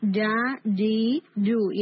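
جا، ڈی، ڈو with a hard clean j and long held vowels; strong hit without air. Shown as a demonstration. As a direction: strike each j once, then hold the vowel. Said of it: "strong ہٹ کی sound آئے گی without air".

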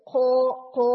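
खो with a rough breathy kh; breathy खो, the wrong way; wrong airy sound. Shown as a demonstration. Teacher showing students the wrong airy sound. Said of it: "यूं नहीं कह रहे".

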